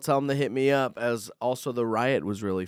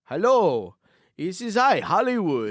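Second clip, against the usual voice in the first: put-on high, loud scam caller accent; mock Indian scam caller; 6 semitones higher, 4 dB louder, slower than usual. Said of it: "an Indian guy being like".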